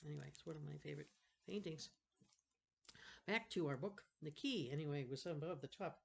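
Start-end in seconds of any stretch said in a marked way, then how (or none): none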